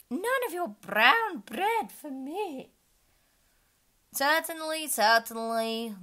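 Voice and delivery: high, loud character voice. Acted. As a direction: read the demand big and high. Unicorn and king voiced.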